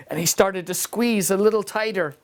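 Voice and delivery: plain reading voice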